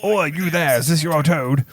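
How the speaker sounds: bad impression of a gruff giant's call; Hagrid. Groundskeeper calling first years about a lost toad, badly imitated. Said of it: "such a bad Hagrid".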